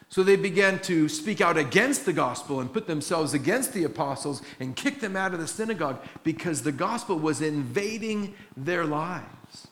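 ordinary talk, nothing marked

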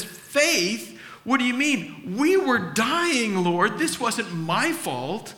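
indignant protest. Retold or imitated. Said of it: "My natural response would be this".

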